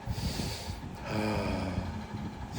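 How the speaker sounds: long, very soft sigh out; demonstrated exhale. Shown as a demonstration. Parent helps child breathe, sighing out. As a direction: let the breath go slowly.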